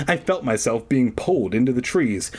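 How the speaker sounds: ordinary talk, nothing marked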